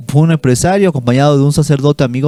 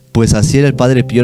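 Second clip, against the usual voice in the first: normal talking voice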